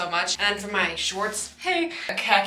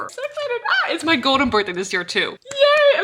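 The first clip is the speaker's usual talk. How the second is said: much higher squealing birthday excitement; higher, louder yay for her golden birthday; excited squeal; 8 semitones higher, 4 dB louder than usual. Real, not acted. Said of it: "Sorry, I'm getting excited".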